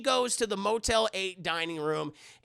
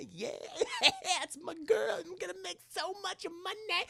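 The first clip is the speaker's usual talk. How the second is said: laughing [name]; 12 semitones higher, 5 dB quieter than usual. Retold or imitated.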